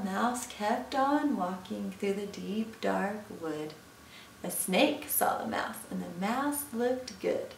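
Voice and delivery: plain reading voice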